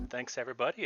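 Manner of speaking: ordinary talk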